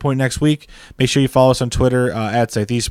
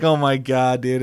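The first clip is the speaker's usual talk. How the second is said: normal talking voice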